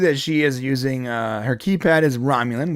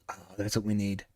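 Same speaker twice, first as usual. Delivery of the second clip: murmured, low, imitating a producer's sudden idea; a quiet mock eureka under the breath; hushed mock producer; 6 semitones lower, 12 dB quieter, faster than usual. Imitating the producer who spots his gardener, hushed and low.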